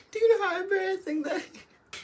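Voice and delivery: high, laughing through the words. Real, not acted. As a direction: crack up while asking, half crying. Laughing about almost falling.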